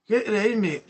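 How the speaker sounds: lightly voiced London pub bloke, demanding and rude; mild cockney nagging a mate to buy his round; rough London accent. Streamer plays a pub bloke in a London accent, nagging a mate. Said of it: "Accents as well".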